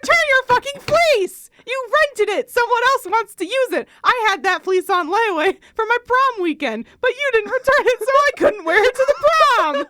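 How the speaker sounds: high, shouted comic tirade; loud, aggrieved outburst with real bite; spiteful rant. Acted. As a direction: build to a hammed-up screech of grievance. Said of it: "There's some real spite behind here".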